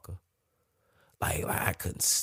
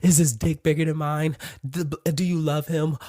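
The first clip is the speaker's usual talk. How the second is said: insecure jealous questions; 10 semitones higher, 6 dB louder than usual. Retold or imitated. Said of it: "asking Kitty shit like".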